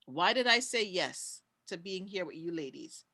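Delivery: ordinary talk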